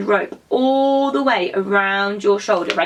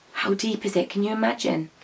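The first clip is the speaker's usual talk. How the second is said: gasp, then a soft awed question; leaning over the well, hushed and amazed; hushed awe; 6 dB quieter than usual. Pretend. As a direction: peer into the dark and wonder softly. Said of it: "Can we all peer down?".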